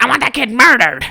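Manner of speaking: hammed-up furious mother; imitating the girl's outraged mom; angry mom. Angry mom quoted, high far voice.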